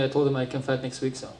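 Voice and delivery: ordinary delivery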